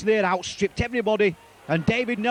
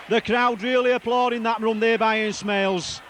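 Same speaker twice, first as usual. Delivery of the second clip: ordinary talk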